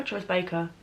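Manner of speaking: ordinary talk, nothing marked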